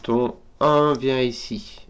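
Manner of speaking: slow, deliberate explaining tone; slow emphatic. Real, not acted. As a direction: spell the step out slowly. Explaining a sudoku step.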